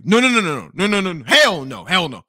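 rapid-fire string of no's, loud at the start; emphatic refusal. Retold or imitated. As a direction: refuse outright, punching the hells. Acted refusal of her offer.

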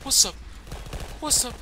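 high taunting bro voice; frat bro. Acted. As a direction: gloat like a cocky frat bro. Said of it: "taunting after a kill".

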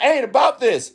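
high-pitched ranting outburst, pitch shooting up; mad screaming. Retold or imitated. Retelling a young woman's rant about working forty years, high mock yell. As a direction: retell her going off, mock outrage. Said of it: "She was screaming".

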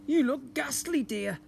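lightly voiced cheery dad; Mr Large's voice. A narrator's reading. Husband greets his tired wife, lightly voiced dad.

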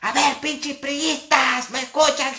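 high, drawn-out call to the crowd; mic heckling call. Retold or imitated. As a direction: taunt the party faithful over the mic. Speaker at the microphone again.

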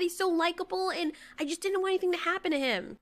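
normal talking voice

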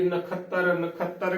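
ਨਖੱਤਰ said na-khattar, no e glide; wrong pronunciation. Shown as a demonstration. Teacher shows the mistake, ਨਖੱਤਰ as na-khattar. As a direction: misread ਨਖੱਤਰ with a bare short a after ਖ. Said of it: "ਗਲਤ ਉਚਾਰਨ ਹੋਵੇਗਾ".

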